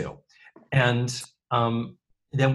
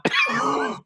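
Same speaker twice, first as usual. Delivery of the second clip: sharp high gasp; surprised gasp. Acted. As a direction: gasp in shock. Gasp at Eddie's arrival.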